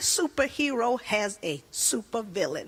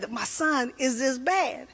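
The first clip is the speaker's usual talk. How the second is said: ordinary delivery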